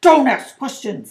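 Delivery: loud, irritated rebuke; cross, forceful order; snapped. A narrator's reading. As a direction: snap at the boy to end it. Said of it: "snapped his uncle".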